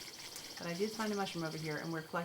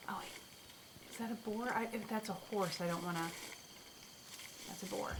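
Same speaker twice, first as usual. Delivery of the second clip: hushed whisper; 8 dB quieter than usual. Real, not acted. Streamer creeping up on a boar, whispering. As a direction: whisper so the boar won't hear.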